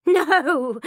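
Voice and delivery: loud high cry of no. A narrator's reading. Mother crying out against the pregnancy. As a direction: cry out in alarm. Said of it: "she cries".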